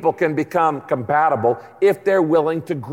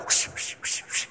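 quick voiceless swishes; soft, breath-only whoosh effects; whooshing blade noises; 10 dB quieter than usual. Shown as a demonstration. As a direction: imitate a sword cutting the air. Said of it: "your tongue is like".

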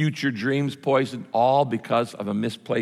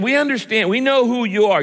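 raised, high-pitched, pressing accusation; insistent accusation; 9 semitones higher, 5 dB louder than usual. Acted. Bystanders tell Peter they recognize him, insistent accusation. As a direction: press the accusation, higher and louder.

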